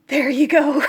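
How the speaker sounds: a short laugh that breaks through, then fades to a breathy chuckle; rueful laugh. Real, not acted. Wrapping up the complaint to her viewers with a rueful laugh. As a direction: laugh off the frustration.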